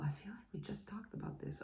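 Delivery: fast whispered aside. Real, not acted. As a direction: whisper it to yourself quickly. Aside about repeating herself.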